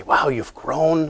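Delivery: slow breathy whisper. Retold or imitated. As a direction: hush it slowly, wide-eyed. Santa greeting old husband.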